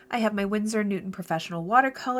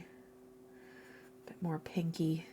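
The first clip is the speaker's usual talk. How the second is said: whisper; 12 dB quieter, much slower than usual. Real, not acted. Muttering to herself while mixing.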